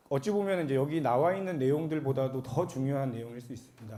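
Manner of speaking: ordinary talk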